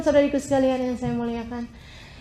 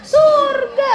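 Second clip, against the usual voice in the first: triumphant answer; 10 semitones higher, 6 dB louder than usual. Real, not acted. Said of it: "answers own question emphatically".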